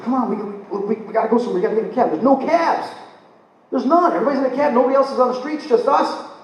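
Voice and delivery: high-pitched, stammering mock panic; imitated freaking out. Woman pleading to find a cab, imitated panic. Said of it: "she's freaking out now".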